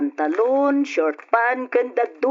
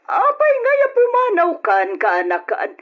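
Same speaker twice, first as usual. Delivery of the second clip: surprised outcry; 13 semitones higher than usual. Acted. Aunt reacts to nephew leaving.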